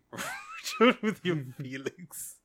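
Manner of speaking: slow, giggly delivery; laughing quote. Retold or imitated. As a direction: drag it out, laughing. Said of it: "Holy Jesus".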